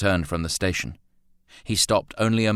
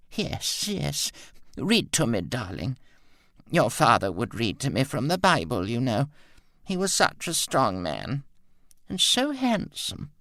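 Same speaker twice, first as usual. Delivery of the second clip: put-on high, wistful, dreamy old lady; soothed frail mother; 7 semitones higher than usual. A narrator's reading. Mother settling as Roland reads, high and dreamy. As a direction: drift off into memories.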